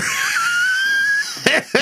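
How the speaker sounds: shrieking burst of laughter, very high; a high laugh bursting out at his own joke. Real, not acted. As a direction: crack up with a piercing high laugh.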